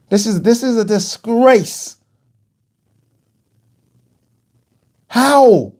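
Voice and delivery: high, loud cry of disbelief at the scores; outraged disbelief. Real, not acted.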